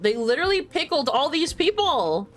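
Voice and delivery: loud, high burst of disbelief; shocked exclamation. Real, not acted. Streamer reacting to the pickled people, loudly incredulous. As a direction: cry it out, pitch and volume jumping.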